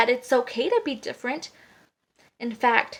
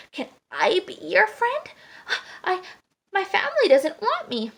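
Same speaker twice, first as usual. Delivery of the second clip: higher, hesitant pleading little duckling; timid plea; 6 semitones higher than usual. A narrator's reading. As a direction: plead haltingly for a friend. Rejected duckling pleads for friendship.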